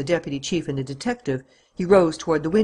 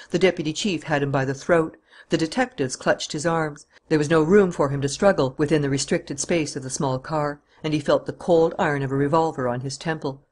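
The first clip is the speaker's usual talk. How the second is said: plain reading voice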